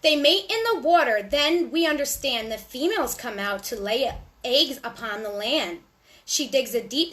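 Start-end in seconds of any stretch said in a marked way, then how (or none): none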